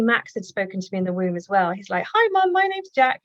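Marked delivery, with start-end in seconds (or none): 2.1-3.2 put-on much higher, louder chirpy little boy greeting; bright child's hello to mum, pitched way up; cheery child voice. Son in the womb greets his mum, cheery high child voice. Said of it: "he was all very cheery".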